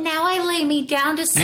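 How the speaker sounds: put-on high, eerie little-kid prayer voice; whisper-sing it like a haunted child; creepy child. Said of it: "that little voice with the prayer".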